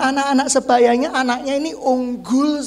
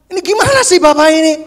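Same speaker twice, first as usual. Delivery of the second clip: exasperated; 4 semitones higher, 7 dB louder, faster than usual. Real, not acted. Exasperated at congregant.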